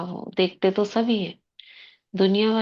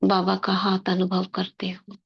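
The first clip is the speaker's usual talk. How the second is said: guided meditation. Acted. Sister guiding the class into soul-consciousness before murli, meditative.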